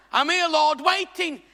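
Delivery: loud, high call to the Lord; hammed-up prayer, pitched high; mock pleading. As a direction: call out like an idle believer waiting. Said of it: "stop being here going".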